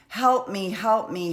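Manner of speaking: retelling a cry for help with a half-hearted yell; retold screaming. Wife retold yelling for help on the trail, mild mock scream. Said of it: "she starts screaming".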